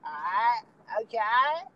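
mock peppy girl, lifting at the end. Imitating girls he hates hearing, lightly mock-peppy. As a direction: lightly put on an over-perky "okay". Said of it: "I hate when girls do that".

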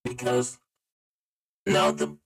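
robotic vocoder with stepped, locked notes. Real, not acted. Player stuck as a vocoder, wanting the voice back, vocoder voice. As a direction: voice run through a vocoder effect. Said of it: "I just wanna switch my voice back".

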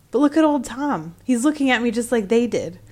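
spooked, pointing at the cat; alarmed. A narrator's reading. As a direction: sexton unnerved by the staring cat. Sexton alarmed by Tom.

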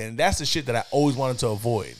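normal talking voice

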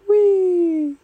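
high squealing wee of joy; gleeful wee. Acted. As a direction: squeal it like a kid racing up a tree. Character cheering in story.

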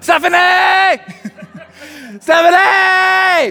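yelling for wife. Retold or imitated. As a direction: holler her name from up the ladder, half laughing. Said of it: "I just yelled for my wife".

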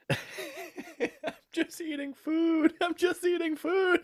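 very high, slow mocking whine; imitating the friend with a silly high voice. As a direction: drag it out in a squeaky mock voice. Mocking buddy's food policy.

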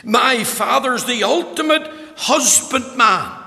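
preaching at full force. Acted. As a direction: preach it out loud and high. Opening of sermon, full force.

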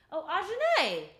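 high, loud realization; excited. Real, not acted. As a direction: blurt the name out high and loud. Naming a friend excitedly.

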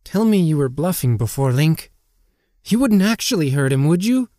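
high, lightly voiced worried question; high, uneasy questioning; anxious fairy companion. A narrator's reading. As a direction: plead for reassurance, pitch climbing. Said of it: "Navi asked in a hushed tone".

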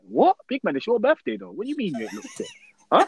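incredulous. Acted. Mock outrage at friend.

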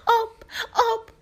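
very high, short calls; desperate cry. A narrator's reading. Lemon girl just out of the fruit begging for water, high cry. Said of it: "فریاد زد".

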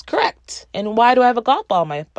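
high-pitched, quick retold outburst; excited mock yell. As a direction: pounce on it like a gleeful quizmaster. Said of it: "Paul excitedly yells".

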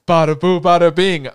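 loud showman's ta-da; playful bada bing. Real, not acted. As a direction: belt it like a magic reveal. Playful transition shout.

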